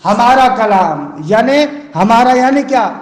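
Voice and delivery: ordinary delivery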